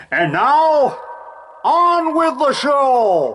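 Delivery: booming slow showman call through echo mic; toy echo microphone. Pretend. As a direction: announce it big and slow, echoing.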